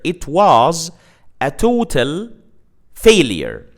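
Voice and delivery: slow spaced "a total failure" with "total" high; stress on total. Shown as a demonstration. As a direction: separate the words, punching total. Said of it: "Total is the emphasi".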